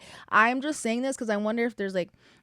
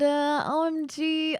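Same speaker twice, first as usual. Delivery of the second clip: slow, higher, stretched O M G; drawn-out OMG; 6 semitones higher, much slower than usual. Real, not acted. Introducing Love Island to listeners, stretched excited OMG. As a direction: savor each letter in mock excitement.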